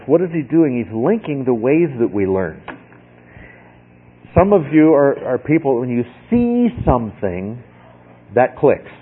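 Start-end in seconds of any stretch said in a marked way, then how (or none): none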